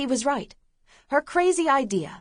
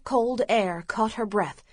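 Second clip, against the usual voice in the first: usual speaking voice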